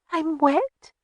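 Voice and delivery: put-on high, tearful little girl, hushed; a frightened child confessing at night; small girl's voice. Little daughter telling her father she wet the bed, high and timid. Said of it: "she whispered".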